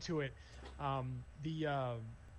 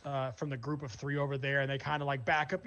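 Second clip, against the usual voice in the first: normal talking voice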